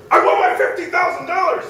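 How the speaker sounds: very high, loud mock tantrum yell; belligerent shout. Belligerent psychic demanding his money, high mock shouting. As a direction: shout the demand at the top of your pitch. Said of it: "he gets really belligerent".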